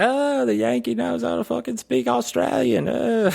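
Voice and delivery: exaggerated high Australian voice, trailing into a long "uh"; mock Aussie. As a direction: squeal it like a hammed-up teasing Aussie. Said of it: "And they're like".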